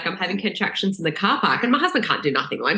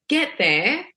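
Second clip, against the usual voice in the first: ordinary talk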